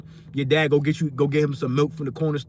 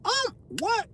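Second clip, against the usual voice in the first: high confused; 3 dB quieter than usual. Retold or imitated. Repeated failed login.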